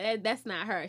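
ordinary talk

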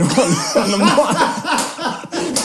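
words dissolving into high laughter; laughing. Real, not acted. Retelling a friend's ban to co-host, helpless laughter. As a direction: crack up mid-sentence. Cracking up at her instruction.

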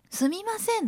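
high, apologetic すみません; typical sumimasen. Retold or imitated. Nurse calling to a patient, high apologetic すみません. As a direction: chirp すみません up high and timid. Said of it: "の時は".

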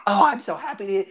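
put-on pleased old hobbit lady; delighted grandmother. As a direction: greet them like a doting grandma. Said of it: "you know, like a grandma".

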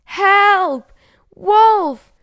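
loud, high cry of alarm; shouting for help. A narrator's reading. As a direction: shout as loudly as you can for rescue. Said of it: "shouting, as loudly as she could".

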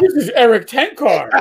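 high, loud proud introduction, half laughing; showing off husband. Retold or imitated. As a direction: imitate a wife boasting of her husband. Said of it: "trying to show off her husband".

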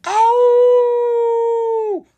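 high, loud wolf howl; long animal howl; werewolf howl. Acted.

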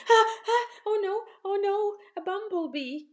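high panicked shriek at a bee; scared girl shrieking oh no; frightened scream. Acted. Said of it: "Lisa started screaming".

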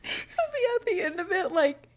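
high voice squeezed by laughter; laughing. Real, not acted. Telling co-host about husband's warning sign, laughing. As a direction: really struggle to talk through the giggles. Cracking up telling farting story.

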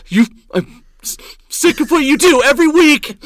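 loud, high imitation of a shaken man's outburst; verge-of-tears yelling. Barista losing it on the apologizing comic, near-tearful yelling. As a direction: stammer into an upset mock yell. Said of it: "sounded like he was on the verge of tears".

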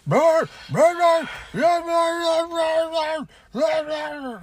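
high, loud yappy "brr" barks; loud, high mock barking; imitating dog barking. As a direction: bark like an excited dog at the door. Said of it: "He just".

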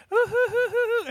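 very high, hammed-up fake sobs; mock fake crying. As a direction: imitate a kid fake-crying for attention. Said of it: "Fake cries are the best".

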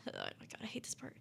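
real dread under the breath; whispered aside. Real, not acted. Storyteller dreading the grim part ahead, whispering. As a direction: drop to a very soft whisper.

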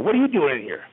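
mildly mean, imitated challenge; retold curt demand. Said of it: "meanly, so to speak".

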